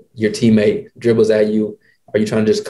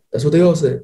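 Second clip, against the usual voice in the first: imitating coach's dry retort; 6 semitones higher than usual. Voicing Carril's dry retort.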